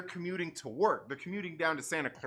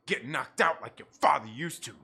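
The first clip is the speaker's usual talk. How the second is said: low mock-menacing taunt; tough guy taunt; 6 semitones lower than usual. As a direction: lean in like a movie villain.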